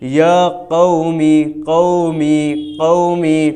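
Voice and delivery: قومی drawn out slowly with a pure Arabic vowel; slow teaching demo of قومی; careful Quranic recitation. Shown as a demonstration. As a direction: spell out قومی slowly, syllable by syllable. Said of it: "یا قومی تو اردو ہو گئی".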